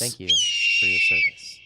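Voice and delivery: host doubling down on a mock salute to viewers; mock salute echo.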